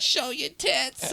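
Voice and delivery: high, drawn-out radio host holler; Howard Stern. Retold or imitated. Imitating the radio host dad played in the car, mock holler. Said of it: "And then Howard Stern would be".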